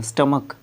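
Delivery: स्टमक with short vowels, closing on K; hard K ending. Shown as a demonstration. Teacher repeats the right form, स्टमक with final K. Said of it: "इसको स्टमक बोलते हैं".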